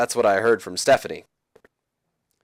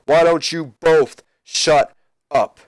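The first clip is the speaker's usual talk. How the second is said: slow, louder Rock voice; commanding shout; 5 semitones higher, 5 dB louder, much slower than usual. Retold or imitated. The Rock silencing Jericho and Flair, slow and loud. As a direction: shut them both down slowly.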